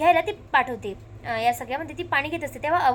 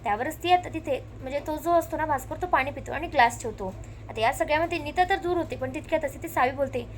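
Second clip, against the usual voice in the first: normal talking voice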